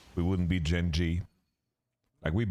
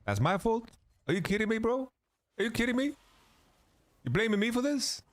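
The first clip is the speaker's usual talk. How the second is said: indignant. Real, not acted. Indignant reply to blame.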